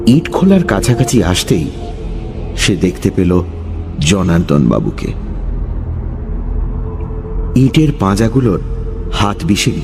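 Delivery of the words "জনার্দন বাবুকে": plain reading voice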